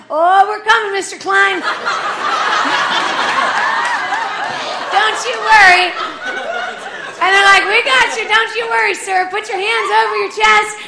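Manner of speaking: overly cheerful reassurance to a dead passenger; mock paramedics. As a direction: coo at the corpse as if he's alive. Paramedics play along with corpse.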